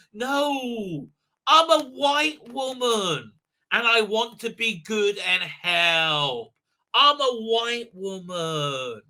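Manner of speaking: slow, drawn-out hammed-up whine; mock whining. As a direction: wail each phrase long and self-righteous.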